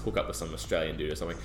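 ordinary delivery

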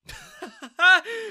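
high, loud scornful cackle; mocking laugh. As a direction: laugh right in her face. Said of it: "Daniel started laughing".

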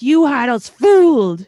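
loud, high, drawn-out cry of betrayal; outraged yell at a reality show couple; mock outrage. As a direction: wail it slowly at full voice, scandalised. Yelling at the screen in retold outrage.

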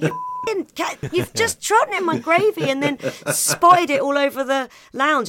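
high, loud exasperated yell at the cat; mock angry owner. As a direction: tell off the cat for the gravy. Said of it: "You'd be like".

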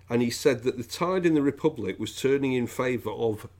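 usual speaking voice

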